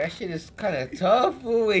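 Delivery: ordinary talk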